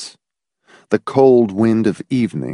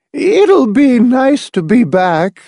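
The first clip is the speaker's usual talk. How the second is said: very high, slow and louder character voice; wavering, uncertain voice; 14 semitones higher, 6 dB louder, slower than usual. A narrator's reading. Edith Pritchett on returning home, wavering and uncertain. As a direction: draw it out high and unsure. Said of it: "in a wavering, uncertain voice".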